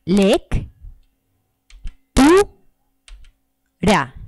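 lectura split into Lec, tu, ra with long pauses; clapped syllables. Shown as a demonstration. Teacher clapping out lectura for the class, syllable by syllable. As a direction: beat out Lec, tu, ra one syllable per clap. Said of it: "Mediante golpecitos o palmadas".

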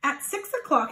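usual speaking voice

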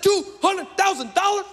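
high-pitched mock disbelief; incredulous echo. Preacher hearing what the doctorate cost, high incredulous echo. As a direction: squawk the sum back, pitch way up. Preacher repeats price in shock.

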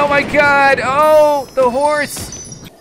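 high, loud panicked cry; alarmed, loud outburst; real panic. Real, not acted. Panicking as the horse is endangered.

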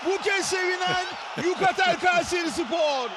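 high excited call; screaming football commentator. Real, not acted. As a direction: keep the goal energy up. Excited commentator after goal.

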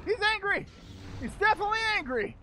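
high, loud battle commentary; excited alarm. Real, not acted. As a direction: call out in mid-fight alarm. Fight with angry monster.

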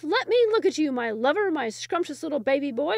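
very high, loud, hooting lovestruck woman; baboon-like call. Acted. Eager bride calls to the Sultan, high and hooting. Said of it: "like the call of a baboon".

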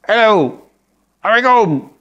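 put-on high, earnest boy's hello; kid's greeting again. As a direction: replay the kid's innocent salam. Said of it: "Aku terbayang muka budak tu". (budak naif beri salam di pentas)

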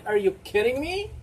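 usual speaking voice

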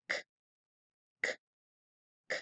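isolated k sound, crisp and voiceless, said three times. Shown as a demonstration. Teacher sounding out the k of caballo. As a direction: tap out a dry "k" with no vowel. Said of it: "empieza con el sonido".

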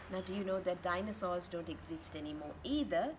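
ordinary delivery, nothing marked

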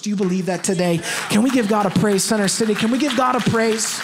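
loud, rousing preacher's shout; rallying call. Real, not acted. Preacher calling Center City to praise God, rallying shout. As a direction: whip the crowd up at full volume. Preacher rallies congregation to applaud.

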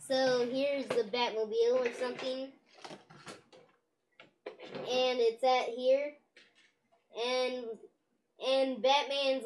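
usual speaking voice

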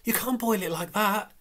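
high, loud pleading; horrified protest. Acted. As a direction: beg her not to do it. Belacqua still protesting.

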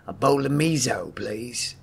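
put-on light gravelly accent, ordering soup; a quick nod to a gravelly action-star accent; Jason Statham. Retelling a restaurant customer ordering soup, gravelly accent. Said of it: "he said it with that gravelly accent".

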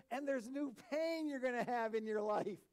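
high, loud mock-enthusiastic announcement; James. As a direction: proclaim bad news as if it were wonderful. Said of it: "you know what James says?".

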